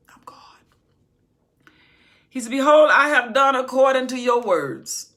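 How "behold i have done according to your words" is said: loud, ringing voice of God; thunderous pulpit declaration; booming proclamation. Acted. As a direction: announce it with full power. God's voice proclaimed.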